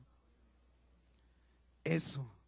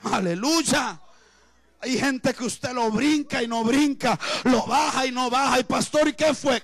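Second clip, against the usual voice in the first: loud, high, full-force sermon shouting; fiery preaching; 10 semitones higher, 16 dB louder than usual. Acted. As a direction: preach it hard to the congregation, voice up and loud.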